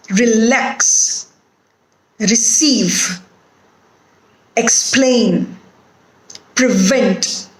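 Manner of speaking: "Relax, receive, explain, prevent" each stressed on the last syllable; second-syllable stress. Shown as a demonstration. Teacher illustrating rule two, "Relax, receive, explain, prevent" end-stressed. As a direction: lean on LAX, CEIVE, PLAIN, VENT. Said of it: "the second syllable is stressed".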